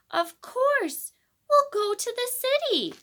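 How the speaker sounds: a joyful mother kangaroo; excited. A narrator's reading. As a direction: burst out with the happy idea. Katy's excited realisation.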